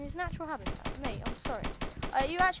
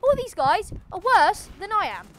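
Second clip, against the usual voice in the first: cocky taunt; 7 semitones higher, 7 dB louder than usual. Pretend.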